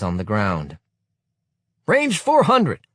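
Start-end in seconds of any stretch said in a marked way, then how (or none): 1.9-2.9 high, loud, slow barked range call; snapped order. Acted. Youth leader commanding the boys, barked order. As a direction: snap out the range like a drill sergeant. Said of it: "snaps the same voice".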